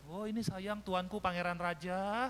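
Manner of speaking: mock doting. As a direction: coo grandly over a pampered little prince. Mock doting mother greets child. (menirukan ibu memanjakan anak, lambat dan berlebihan)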